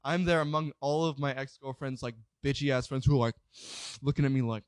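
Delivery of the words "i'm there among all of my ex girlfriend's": usual speaking voice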